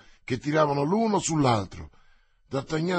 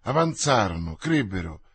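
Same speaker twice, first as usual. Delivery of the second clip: plain reading voice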